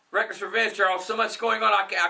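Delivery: ordinary delivery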